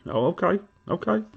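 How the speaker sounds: sarcastic okay. Real, not acted. Sarcastic reaction to not being paid.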